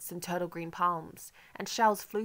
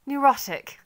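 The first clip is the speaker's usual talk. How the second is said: much higher and louder, spitting the word; scornful outburst; 8 semitones higher, 10 dB louder, slower than usual. A narrator's reading. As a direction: fling the word back with scorn. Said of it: "I let out a scornful laugh".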